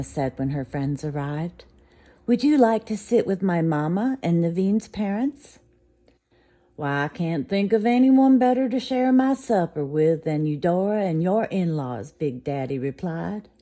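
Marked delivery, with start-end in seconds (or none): 2.3-5.6 higher, louder hostess offer; friendly hostess. Acted. Hostess offering friends a seat, bright and louder. 6.8-12.1 higher, louder hearty reply; jolly southern gent. Acted. Big Daddy answering the hostess, hearty and louder.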